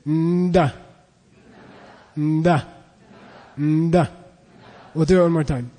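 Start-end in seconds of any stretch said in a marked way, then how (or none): 0.1-4.1 'Nda' led in by a humming N; prenasalised D. Shown as a demonstration. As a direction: hum a little N before 'Nda'. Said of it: "say N and then say D".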